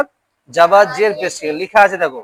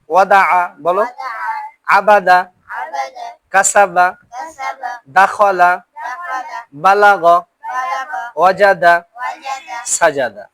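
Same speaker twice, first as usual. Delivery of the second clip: reading drill; much slower than usual. Shown as a demonstration. Teacher reading zabar words aloud for pupils to follow. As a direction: read each word out for the class to repeat.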